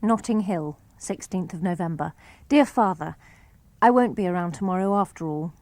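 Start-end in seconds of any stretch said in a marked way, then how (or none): none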